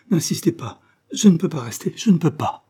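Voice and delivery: pleading cry. Acted. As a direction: plead with hands clasped, near tears. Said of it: "s'écria-t-elle en joignant les mains". (supplication haute et forte, au bord des larmes)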